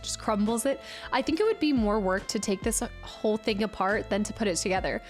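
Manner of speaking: ordinary delivery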